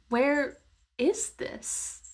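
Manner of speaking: normal talking voice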